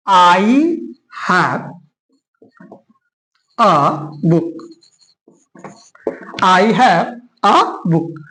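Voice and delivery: low, stretched words with long gaps; very slow, separated English words; slow dictation. Shown as a demonstration. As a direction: spell it out word by word for the class. Writing out the English translation.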